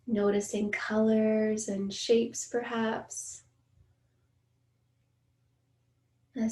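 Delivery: measured meditation guide, long pauses between phrases; guided meditation. Acted. Meditation teacher inviting listeners to notice their senses, guided meditation voice. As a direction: ease listeners gently through each sense, unhurried.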